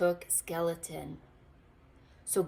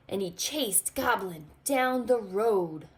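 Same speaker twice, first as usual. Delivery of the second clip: ordinary delivery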